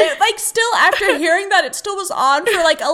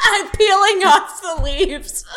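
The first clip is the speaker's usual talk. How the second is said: laughing; 7 semitones higher, 4 dB louder than usual. Real, not acted. Laughing while joking back.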